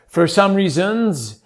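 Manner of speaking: slow, drawn-out Quebecois phrasing; French-Canadian accent on a stray plural. Shown as a demonstration. Performer showing a trick, Quebecois accent. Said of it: "putting a plural to a singular".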